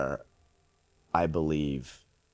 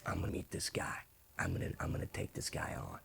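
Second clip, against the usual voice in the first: very quiet, low acted vow; hushed resolve; 13 semitones lower, 14 dB quieter than usual. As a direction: say it almost under the breath, resolved. Character's resolve, retold from scene.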